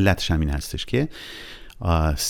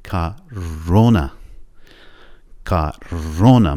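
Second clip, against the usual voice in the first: کارونا, very slow, with the weight on the second syllable -رو-; stress on second o; much slower than usual. Shown as a demonstration. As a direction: drag کارونا out and hit -رو- hard. (کارونا آهسته با فشار روی او دوم)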